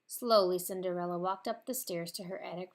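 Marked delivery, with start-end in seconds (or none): none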